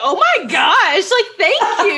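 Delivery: high, loud squeal of thanks; delighted high-pitched gush. Retold or imitated. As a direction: gush over the compliment, squealing. Gushing thanks retold.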